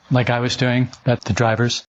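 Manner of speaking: normal talking voice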